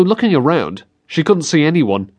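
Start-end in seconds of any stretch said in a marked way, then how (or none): none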